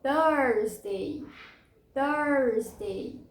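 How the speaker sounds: "Thursday" as THURZ-day with an R-coloured vowel; model pronunciation. Shown as a demonstration. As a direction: draw out the first syllable of "Thursday", THURZ-day. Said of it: "totalmente diferente escrita e pronúncia".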